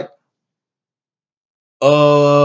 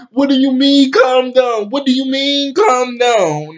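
imitating an outraged, high-pitched protest; indignant retort; 9 semitones higher than usual. Vlogger voicing a woman told to calm down, indignant. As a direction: throw it back in a raised, high voice.

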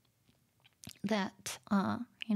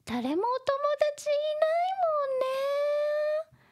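little sister voice; much faster than usual. Acted. Voicing little sister tease.

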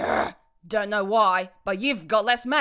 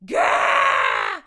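pained cry; 12 semitones higher, 6 dB louder than usual. Acted. Villain hit by sand attack.

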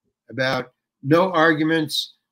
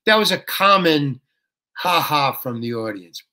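ordinary talk

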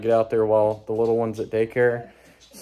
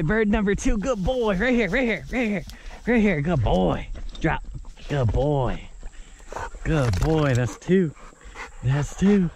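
higher, eager coaxing and praise; higher dog-praise voice; 6 semitones higher than usual. Real, not acted. Hunter calling his dog to bring bird number two: higher, eager praise.

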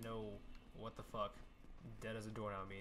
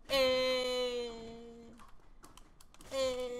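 high yelped "Hey" as he dies; death cry; 11 semitones higher, 7 dB louder than usual. Acted. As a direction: yelp it out like a dying hero. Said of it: "That's my death cry".

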